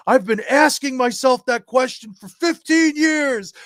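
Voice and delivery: loud, high-pitched mock complaint; retold cry of long-held exasperation; imitated exasperated outburst. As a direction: act out a worn-out coworker exclaiming. Acting out coworker's exasperated outburst.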